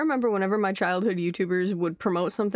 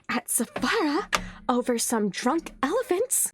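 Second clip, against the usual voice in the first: higher, whiny mock-tantrum voice; mock whining; 5 semitones higher than usual. Vlogger mocking angry kids at Sephora, mock whining. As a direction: pitch it up like a kid having a tantrum.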